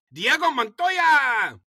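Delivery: put-on slow, loud Latin accent calling out a name. Supreme Master names the recruit in a loud Latin accent. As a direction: roll the name out like a proud boss. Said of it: "this is my Latin accent".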